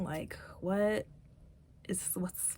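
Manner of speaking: concerned whispered question. Retold or imitated. Girlfriend asks what's wrong, whispering. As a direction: breathe it out, worried and hushed.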